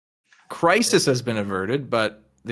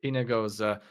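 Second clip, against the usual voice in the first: normal talking voice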